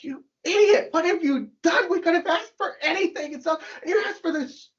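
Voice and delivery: hammed-up outburst at a foolish wife; angry husband. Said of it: "the husband gets so angry".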